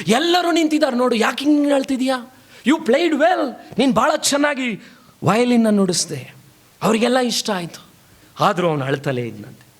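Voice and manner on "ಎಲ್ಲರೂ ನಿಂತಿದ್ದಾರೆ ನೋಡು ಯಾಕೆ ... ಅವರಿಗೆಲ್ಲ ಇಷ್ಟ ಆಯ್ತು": high, animated urging of a crying performer; acted, higher-pitched reassurance; eager encouragement. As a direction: voice an onlooker cheering someone up. People cheer up weeping violinist.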